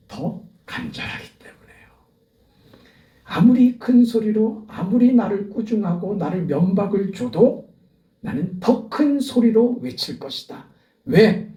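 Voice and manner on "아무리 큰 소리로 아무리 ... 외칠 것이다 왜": louder, higher, rising sermon fervor; a pastor's fervent peak in the sermon; full-force preaching. Acted. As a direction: preach it out to the congregation.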